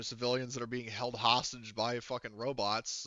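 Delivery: ordinary talk, nothing marked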